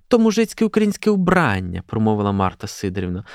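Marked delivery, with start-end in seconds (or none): 0.1-1.8 high, emphatic matron voice; disapproving. A narrator's reading. Marta telling girls it is peasant dress, disapproving. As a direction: declare it with raised, indignant pitch.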